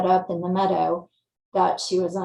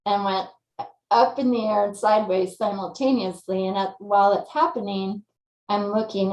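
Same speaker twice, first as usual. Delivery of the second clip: ordinary talk, nothing marked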